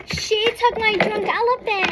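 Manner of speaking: put-on high, whiny little-girl accusation; whiny kid accusation.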